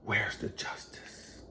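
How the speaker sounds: bitter whisper. Real, not acted. Bitter question to God.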